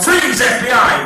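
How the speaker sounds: loud FBI bust yell; shouted command. Shown as a demonstration. Hypnotist demonstrating the spy's shout, loud. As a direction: bark it like an agent arresting someone. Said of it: "and you shout".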